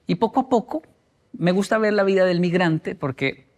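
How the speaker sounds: ordinary delivery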